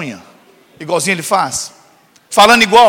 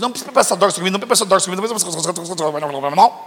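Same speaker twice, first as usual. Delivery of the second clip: mock grumbling teen; 3 semitones lower, 5 dB quieter, much faster than usual. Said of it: "Eu falei".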